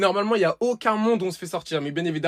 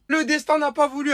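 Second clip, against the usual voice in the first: much higher, louder lament; emphatic; 8 semitones higher, 4 dB louder than usual. Real, not acted. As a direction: cry out at fate's cruelty. Repeats the phrase with emphasis.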